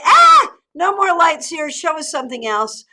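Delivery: loud, high mock outcry from a viewer; mock protest. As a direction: burst out in pretend protest. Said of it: "If you want me to switch it up more, say".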